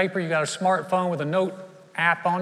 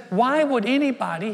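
incredulous; 8 semitones higher, 5 dB louder than usual. Retold or imitated.

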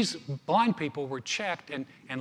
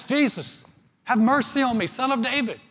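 high, raised plea; acted crying out; 7 semitones higher, 4 dB louder than usual. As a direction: call out for mercy, pitched high. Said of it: "he began to express".

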